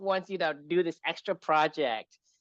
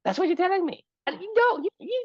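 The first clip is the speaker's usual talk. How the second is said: insistent high pitch; 13 semitones higher than usual. Real, not acted. Pushing back, voice jumps high.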